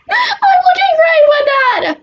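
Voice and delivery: a drawn-out high shout of pride; showy high yell. Acted. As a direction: belt it out like a proud show-off. Repeats the boast as a yell.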